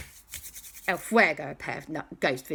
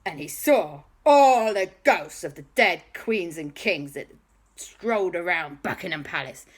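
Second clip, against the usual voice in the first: ordinary delivery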